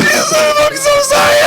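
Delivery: very high, slow, crying wail; drawn-out mock sobbing sorry; sobbing apology. Man calling friends, sobbing apologies. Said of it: "And I'm like".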